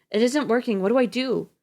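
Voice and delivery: husband failing to revive his wife; voice breaking. A narrator's reading. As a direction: crack with helpless panic. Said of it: "His voice breaks".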